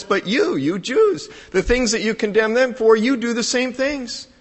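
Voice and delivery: high-pitched, pointed accusation; preacher voicing an apostle's reproach; acted rebuke. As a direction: turn the charge back on the listeners.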